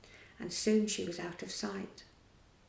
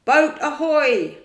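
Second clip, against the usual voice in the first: loud, slow hail across the water; ringing call; 6 semitones higher, 13 dB louder, slower than usual. A narrator's reading. Hook hails the boat loudly from the water. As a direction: call out long across the lagoon. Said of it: "Hook's voice rang over the lagoon".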